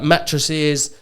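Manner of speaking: ordinary delivery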